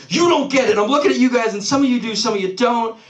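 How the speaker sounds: high-pitched frustrated mock scolding; exasperated. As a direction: imitate a brother fed up at not being understood. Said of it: "he yells at me".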